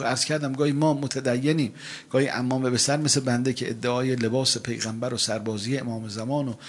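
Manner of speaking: ordinary talk, nothing marked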